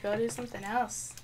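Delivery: ordinary talk, nothing marked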